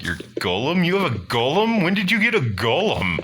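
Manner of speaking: high, fast, astonished outburst; incredulous questioning. Acted. Teammate learns the character has a golem, astonished. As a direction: climb sharply in pitch with disbelief.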